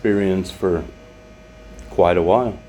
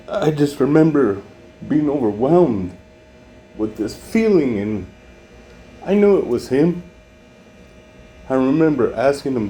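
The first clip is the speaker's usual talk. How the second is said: high, strained voice, louder, on the edge of crying; overwhelmed while reliving the moment; emotional, near tears; 6 semitones higher, 4 dB louder than usual. Real, not acted. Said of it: "get a little emotional".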